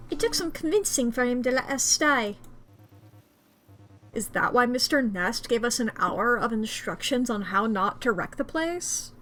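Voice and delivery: ordinary delivery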